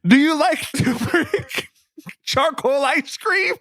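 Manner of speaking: high, slow mocking question through laughter; dramatic reading. Reading a tweet to the group, in a high, slow mocking voice. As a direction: ask it like a mock interrogation, breaking into laughs. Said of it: "a dramatic Jenkins and Jones reading".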